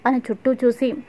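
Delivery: plain reading voice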